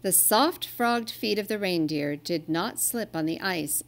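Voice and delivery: ordinary delivery, nothing marked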